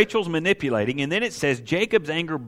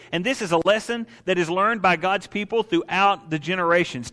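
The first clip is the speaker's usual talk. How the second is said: ordinary delivery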